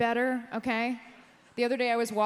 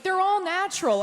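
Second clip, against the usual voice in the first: mock defensive friend; 9 semitones higher, 6 dB louder than usual.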